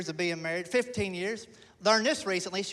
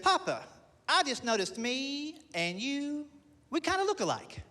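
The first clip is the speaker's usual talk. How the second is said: put-on high little boy voice, slow; son's voice; 6 semitones higher than usual. Son noticing he looks like dad, high childlike. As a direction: play the kid, small and earnest. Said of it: "He said".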